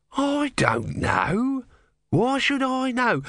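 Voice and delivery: high, drawn-out protest; exasperated. A narrator's reading. Tortoise god fending off disciple, high exasperated protest. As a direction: stretch the words in high exasperation. God defends himself in quarrel.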